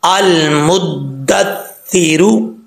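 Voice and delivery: अल मुद्दस्सिरू slow, both doublings held; correct recitation. Shown as a demonstration. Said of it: "कैसे पढ़ेंगे sound में".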